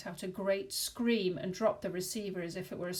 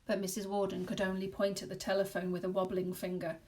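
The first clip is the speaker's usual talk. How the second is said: plain reading voice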